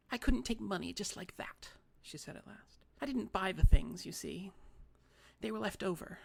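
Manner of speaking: put-on a wronged old lady sulking; fussy little old lady. A narrator's reading.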